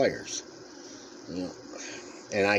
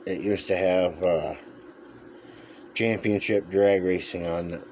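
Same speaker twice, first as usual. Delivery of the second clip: ordinary talk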